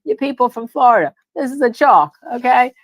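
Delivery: hammed-up shout at the out-of-towners; mock Massachusetts lawyer. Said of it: "somebody from Massachusetts".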